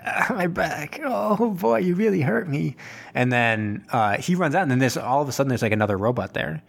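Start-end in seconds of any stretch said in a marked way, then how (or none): 0.1-2.8 put-on whiny kid voice. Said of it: "And Shiro's like".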